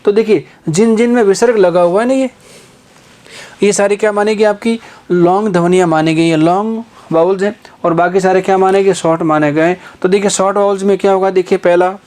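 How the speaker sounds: ordinary delivery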